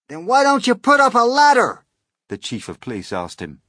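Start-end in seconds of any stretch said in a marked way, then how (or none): 0.1-1.9 high, loud, insistent question; loud urging. Acted. Police chief pushing the fire chief, loud urging. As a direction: press the other man to act.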